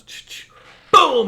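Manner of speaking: whispered ch-ch then a loud boom; shotgun blast sound. Retold or imitated. Hunter firing at the lone duck, sudden loud boom.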